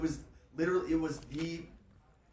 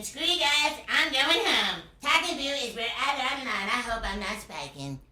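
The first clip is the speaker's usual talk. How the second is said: much higher, louder cartoon kid voice; whiny bratty cartoon boy going home; high loud Cartman impression; Eric Cartman voice; 9 semitones higher, 8 dB louder than usual. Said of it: "I also do Eric Cartman".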